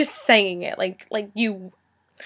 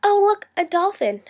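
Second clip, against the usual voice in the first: higher, airy giggling quip; ditzy classmate; 9 semitones higher, 3 dB louder than usual. Retold or imitated. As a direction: blurt it like a distracted classmate, laughing. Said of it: "and she just goes".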